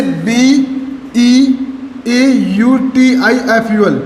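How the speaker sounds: letters of beautiful spelled slowly one by one; slow, careful correct spelling. Shown as a demonstration. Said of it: "इन्हीं को क्या बोलते हैं? Spelling".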